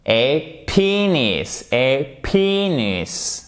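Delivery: "penis" with long drawn-out stressed PEE; very slow "Et penis", a separate ay, then PEEE-nis; mispronounced contrast. Shown as a demonstration. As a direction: stretch the first vowel of "penis". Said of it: "Ça n'a rien à voir avec happiness".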